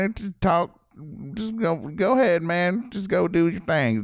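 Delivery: a hammed-up lazy hill-country ramble, up high; Boomhauer. Imagined Kentucky hill folk shrugging off Nazis, in a high Boomhauer voice. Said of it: "It sounds like Boomhauer".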